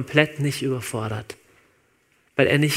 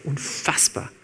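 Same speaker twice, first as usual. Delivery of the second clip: unfassbar whispered, softer, voiceless; 6 dB quieter, slower than usual. Real, not acted. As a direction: repeat unfassbar as a hushed whisper. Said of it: "es ist unfassbar". (unfassbar geflüstert)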